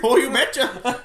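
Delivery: high, loud, slow Wisconsin yes; Wisconsin accent. Acted. Co-host as local promising brats, mock Wisconsin accent. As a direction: agree like a hammed-up Wisconsinite. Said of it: "they're like".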